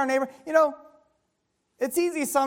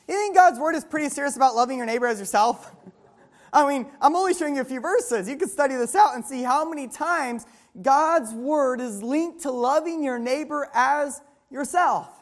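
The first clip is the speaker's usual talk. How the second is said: ordinary talk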